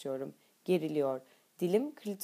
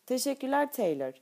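higher-pitched, light call; calling after someone; 7 semitones higher than usual. A narrator's reading. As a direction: call thanks to someone walking off. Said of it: "diye arkasından sesleniyor".

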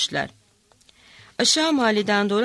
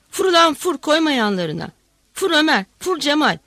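urging shout; 5 semitones higher, 6 dB louder than usual. A narrator's reading. Hatça urging on in fight.

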